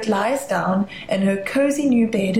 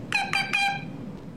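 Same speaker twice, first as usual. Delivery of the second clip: very high, soft, slow squeaks; tiny scared chick squeaks; squeaking chick; 7 dB quieter, slower than usual. Acted. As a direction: squeak timidly like a shivering chick. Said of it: "the creature squeaks".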